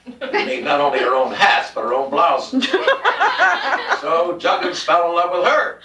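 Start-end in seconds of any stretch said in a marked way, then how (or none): 2.7-4.0 high, bright laugh breaking out; burst of laughter. Real, not acted. Reader laughs at own story.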